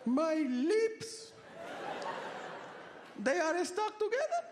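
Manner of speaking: high-pitched mock voice of a stoned mother; imitated stoned mother. Comic imitating his high mother.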